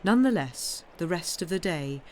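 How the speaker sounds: ordinary delivery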